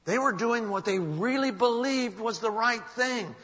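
high, loud, impassioned sermon; preacher at full conviction; forceful preaching. Real, not acted. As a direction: hammer the point, stretching the key words. Preacher drives home the lesson.

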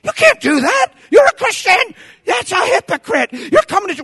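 high, scandalized scolding; hammed-up indignant rebuke; mock outrage. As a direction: parody a judgmental counselor, pitch jumping up. Parodies a judging counselor.

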